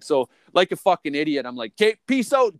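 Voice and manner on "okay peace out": loud, higher-pitched retold farewell; breezy exit line, pitched up; quick bail-out call. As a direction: call it out and take off. Acting out abrupt exit from crash.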